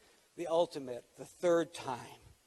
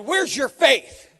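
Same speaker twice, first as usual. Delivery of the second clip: slow, much louder and higher call; preacher voicing Jesus calling out in the boat; bold rebuke; 7 semitones higher, 14 dB louder, slower than usual. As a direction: ring it out over the storm. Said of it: "He got up and said".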